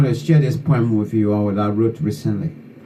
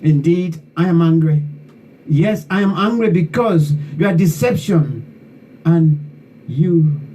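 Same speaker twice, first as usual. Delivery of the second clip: slow, higher-pitched declaiming with indignant repetition; angry poem recital; 4 semitones higher than usual. Acted. Poet reading own poem to those who betrayed Liberia, indignant declamation. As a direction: recite an indignant protest poem, drawing out key words.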